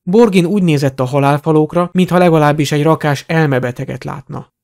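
ordinary delivery

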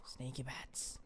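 very soft, low playful whisper; sneaky whisper. Pretend. Player sneaking up on enemies as a bat, whispering. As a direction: whisper it, creeping up unnoticed. Creeping as bat character in game.

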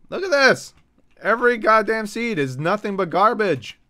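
high, loud exasperated complaint; frustrated outburst. Real, not acted. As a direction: burst out fed up with the game.

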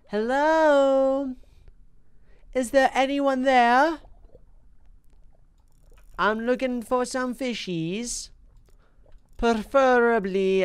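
lilting hello to the fish; playful calling out. Pretend. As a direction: call out like searching an empty room.

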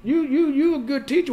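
high, emphatic praise. Acted. Husband praising his wife's teaching, high and emphatic. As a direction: insist she is a good teacher.

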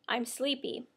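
usual speaking voice

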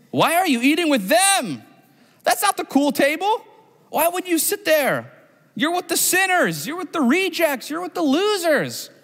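put-on high, sneering mean-girl voice, slowing on the insults; mock the kids at the cool table; snobby cafeteria kid. Preacher mocking Pharisees as middle school snobs, high and sneering.

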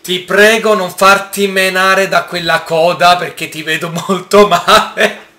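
loud plea dissolving into laughter; laughing plea. Real, not acted. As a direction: beg while laughing. Begging the stegosaurus.

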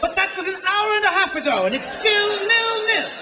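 lightly voiced British soccer commentator; mock British play-by-play announcer, riveted by nothing. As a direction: play the breathless TV commentator over a dull match.

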